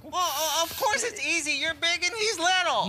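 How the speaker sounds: nervous laugh. Acted. Nervous laughing reply to the wolf.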